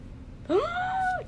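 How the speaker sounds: high, drawn-out gasp; surprised gasp. Real, not acted. Listener hears the band played in town, surprised gasp. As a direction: gasp with sudden interest. Reacts to news of concert.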